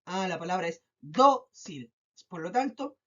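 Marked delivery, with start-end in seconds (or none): 0.3-1.9 "dócil" slowly as DÓ, cil; stress on first syllable. Shown as a demonstration. Teacher giving the answer, stress on DÓ of "dócil". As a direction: settle on a long DÓ in "dócil", cil falling. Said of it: "se carga en la letra".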